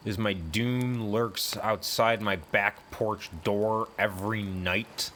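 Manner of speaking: ordinary delivery, nothing marked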